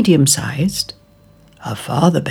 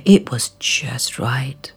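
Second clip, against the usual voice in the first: slow, soft contented whisper; whispered pleasure at the little chair; slow whispered satisfaction; 4 semitones lower, 4 dB quieter, slower than usual. Acted. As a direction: murmur it like it's perfect. Goldilocks finds chair right.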